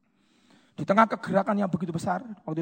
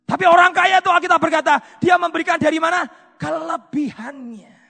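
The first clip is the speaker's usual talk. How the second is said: loud, raised preacher's voice; full-force preaching; 11 semitones higher, 14 dB louder than usual. Real, not acted. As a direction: drive it home to the congregation at full volume. (khotbah lantang berapi-api)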